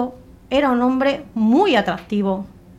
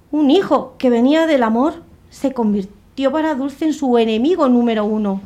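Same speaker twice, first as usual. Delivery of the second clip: ordinary delivery, nothing marked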